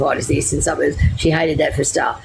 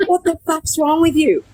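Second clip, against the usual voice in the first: exasperated son; 10 semitones higher than usual. Retold or imitated. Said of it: "And he would just come out and go".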